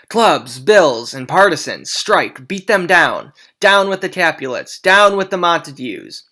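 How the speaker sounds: loud rallying cry, pitch climbing at the end; crowd shouting. Acted. Citizens shout down both feuding families, loud mob cry. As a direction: yell it like a mob egging on the fight.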